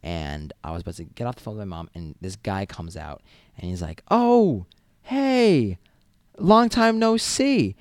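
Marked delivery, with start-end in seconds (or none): 4.1-7.8 high, slow, overly friendly hello; overly friendly stranger. Retold or imitated. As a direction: greet like a long-lost buddy. Stranger greets as old friend.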